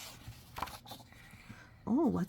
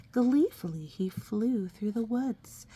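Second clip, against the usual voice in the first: plain reading voice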